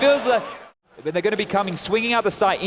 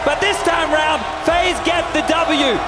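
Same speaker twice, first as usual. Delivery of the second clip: hyped, higher victory commentary; esports caster; 6 semitones higher, 4 dB louder than usual. Real, not acted. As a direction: ride the crowd's energy. Co-caster celebrates the win.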